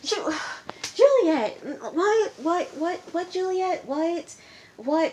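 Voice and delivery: can't believe what she's seeing; exasperated disbelief. Real, not acted. Exasperated outburst at cast member.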